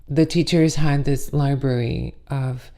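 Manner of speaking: ordinary delivery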